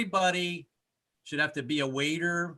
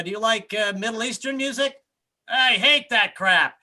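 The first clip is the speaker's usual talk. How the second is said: cab driver; 7 semitones higher, 5 dB louder than usual. Retold or imitated. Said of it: "It's like".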